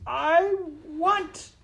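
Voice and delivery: loud, very high wail; crying out. A narrator's reading. Maid crying out her want, high loud wail. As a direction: cry it out like a desperate child. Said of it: "cried Midge".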